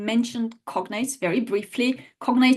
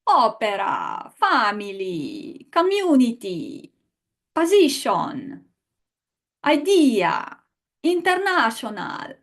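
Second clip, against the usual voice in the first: mock Italian accent. Shown as a demonstration. Teacher shows students how to mock an Italian friend, strong Italian accent. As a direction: put on the worst Italian accent, word by word. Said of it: "pronounce these with a strong Italian accent".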